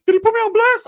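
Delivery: high, loud squeaky voice; cartoonish mock outrage; outraged cousin. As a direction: imitate an indignant cousin in falsetto. Acting out primo's outraged question.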